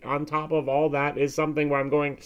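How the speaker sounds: ordinary talk, nothing marked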